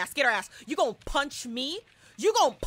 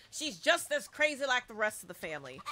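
ordinary delivery

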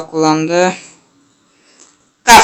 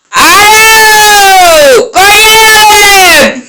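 very high, drawn-out screaming; yelling; 11 semitones higher, 3 dB louder, much slower than usual. Real, not acted. Gamer yells at his players, high long scream. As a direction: scream it out, stretching each word.

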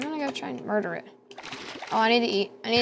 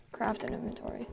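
whisper; 12 dB quieter than usual. Real, not acted. Talking to self while crafting.

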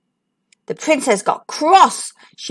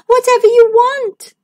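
high, loud eager princess; cartoon princess; 11 semitones higher, 5 dB louder than usual. Princess voiced in story.

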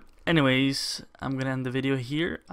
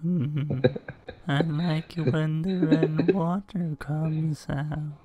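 Salad Fingers voice; 2 semitones higher, much slower than usual. Pretend. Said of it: "Salad finger voice".

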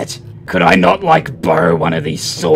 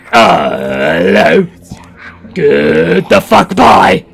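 mock shout; 4 dB louder, much slower than usual. Calling out, then storming off.

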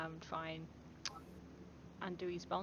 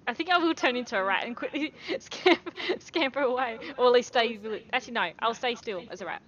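loud, higher, laughing through it; real giddy excitement; 5 semitones higher, 11 dB louder than usual. Real, not acted. Player tells game master a silly escape plan, giddy. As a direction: blurt the plan out loudly, amused.

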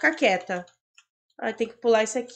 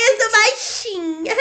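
giggling mock whimper; laughing whine; 8 semitones higher, slower than usual. Real, not acted. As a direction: laugh through a mock complaint. Whining while laughing.

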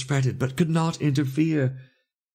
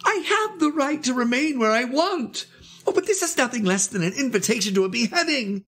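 very high, plaintive protest; high-pitched, sustained wailing; 12 semitones higher than usual. A narrator's reading. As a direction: wail it out in desperate indignation. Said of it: "wailed Vasily Ivanovich".

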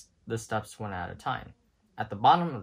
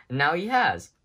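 high, louder fearful cry; fretful outburst; 6 semitones higher, 8 dB louder than usual. A narrator's reading. Rex blaming the dog, high louder fearful cry. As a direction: let the dread burst out. Rex panics over Ham.

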